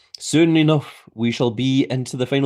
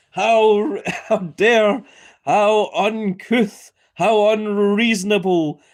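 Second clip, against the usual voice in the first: mock outrage; 6 semitones higher, slower than usual. Reacting to the ropes pulled down.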